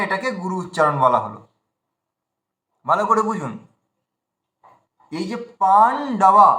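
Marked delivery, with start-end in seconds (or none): 5.6-6.5 পান ডাবা said slowly with a gap after পান; stop mid-word. Shown as a demonstration. Teacher repeats পান ডাবা for learners, slow, split by a pause. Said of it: "পান বলে থেমে গিয়ে তারপরে যে ডবা বললাম".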